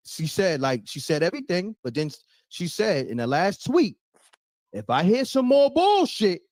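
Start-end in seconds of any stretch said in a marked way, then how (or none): 4.7-6.4 mildly heated warning, pitched up at the start; Carissa Shields. Retold or imitated. Host retelling a boxer's last tweet, mock threatening. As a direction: imitate a boxer's threat, half in earnest. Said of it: "emotions that Carissa Shields had".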